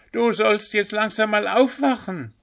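high, loud impatient yell; shouting. A narrator's reading. Hares yelling at the dozing owl, loud.

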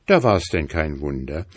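usual speaking voice

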